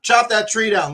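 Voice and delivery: loud, high impatient dismissal, then brisk order. Acted. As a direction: cut through the fuss with a loud command.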